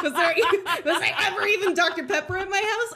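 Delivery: high, loud, laughing questioning; mock hysterical doubt. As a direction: blurt it fast through laughter. Acting out doubting her sanity.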